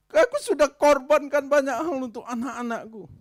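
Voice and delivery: high, loud bitter complaint; aggrieved loud lament. Acted. Preacher voicing a wife wronged by her husband, loud and hurt. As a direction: protest your wasted loyalty.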